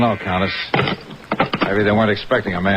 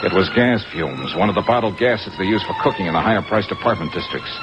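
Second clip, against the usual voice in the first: hard-boiled story narration; 4 semitones higher than usual. Acted. Detective narrates the scene.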